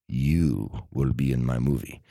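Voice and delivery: lightly voiced Russian director; Russian aristocrat. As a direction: pronounce it like a Russian noble. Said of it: "a Russian, Russian aristocrat".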